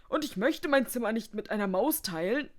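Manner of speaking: high, loud grumpy protest; indignant. A narrator's reading. Guest refusing to share his room, indignant. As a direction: protest loudly as the put-upon guest.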